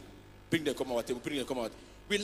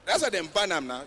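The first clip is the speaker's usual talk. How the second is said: normal talking voice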